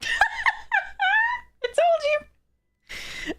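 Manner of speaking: drawn out very slow and very high, half laughing, half crying; stretched, laughing wail of despair. Real, not acted. Wailing at another bad draw.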